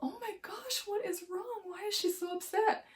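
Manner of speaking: put-on high, small bewildered child voice; high-pitched confused little girl asking; puzzled little kid. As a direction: play your kindergarten self, baffled by tears. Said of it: "And I remember being like".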